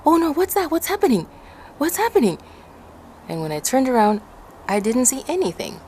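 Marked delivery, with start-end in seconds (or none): 0.1-2.4 panicked. Retold or imitated. Girl on ski slope, frightened, asking what's coming. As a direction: gasp out your confusion in fright.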